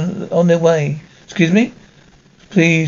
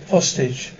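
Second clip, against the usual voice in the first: usual speaking voice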